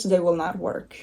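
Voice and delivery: ordinary delivery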